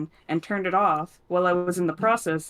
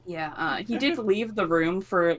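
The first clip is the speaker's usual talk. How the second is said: ordinary talk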